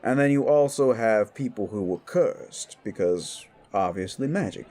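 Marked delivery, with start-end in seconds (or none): none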